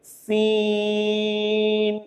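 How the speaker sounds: سین held very long and slow, fading out on the n; long drawn Seen. Shown as a demonstration. Teacher demonstrating Seen to students, drawn out slowly. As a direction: stretch سین for five alifs, then let the n fade. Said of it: "پانچ الف بھی کھینچ کر پڑھیں گے".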